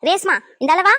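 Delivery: loud call for grandma to come. Acted. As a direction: order her over loudly. Girl summons grandma over.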